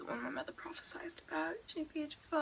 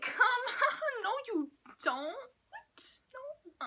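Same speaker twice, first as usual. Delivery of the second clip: incredulous protest; 11 semitones higher, 7 dB louder, slower than usual. Retold or imitated.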